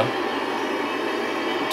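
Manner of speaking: slow, hushed whisper; whispering while hiding. Real, not acted. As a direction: breathe it out so she can't hear. Hiding from the monster.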